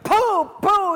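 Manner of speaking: loud, high imitated blows; explosive comic-book hits; punching sound effect. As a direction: throw punches with your voice.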